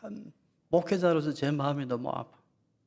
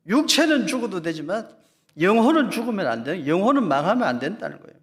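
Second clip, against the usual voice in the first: loud, raised preaching voice; forceful preaching; 4 semitones higher, 7 dB louder than usual. Real, not acted. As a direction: hammer it home to the congregation. (힘찬 설교)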